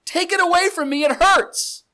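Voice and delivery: hammed-up pleading prayer; pleading whine. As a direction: beg like a hurting child. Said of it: "what is the prayer?".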